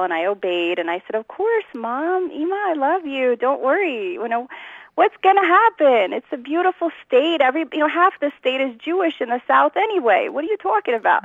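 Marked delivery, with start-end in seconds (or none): 1.1-11.3 mock sugary daughter, much higher; sweet acquiescent girl. Teen girl soothing her worried mother, in a hammed-up sweet voice. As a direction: reassure mom in a high, overly sweet voice. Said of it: "I was such a great acquiescent girl".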